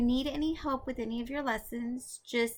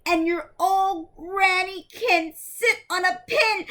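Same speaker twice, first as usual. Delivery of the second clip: much higher and louder, jeering from behind the door; taunting yell; 9 semitones higher, 9 dB louder than usual. Acted. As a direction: yell the insult at the wolf. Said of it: "the little pig yelled".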